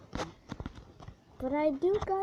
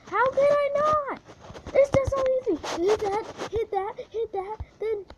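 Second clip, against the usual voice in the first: much higher, loud exasperated rant; frustrated; 10 semitones higher, 6 dB louder than usual. Real, not acted. Gamer ranting at the game, frustrated. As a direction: vent at the game, fed up. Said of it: "I'm so frustrated".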